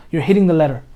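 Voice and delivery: ordinary talk, nothing marked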